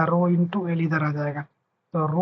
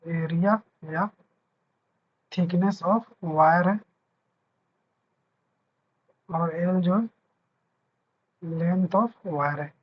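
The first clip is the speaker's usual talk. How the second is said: very slow, word by word, for notes; slow dictation; 4 dB quieter, much slower than usual. Shown as a demonstration. Teacher dictates the formula terms to students, very slowly. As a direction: spell out each term slowly so students can write.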